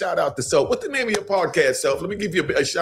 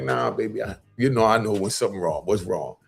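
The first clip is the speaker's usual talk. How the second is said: low, lightly acted mother checking on her child; lightly voiced concerned mother; 7 semitones lower than usual. Mother to son on a prison phone call, lightly voiced concern. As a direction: press gently, in a lower voice.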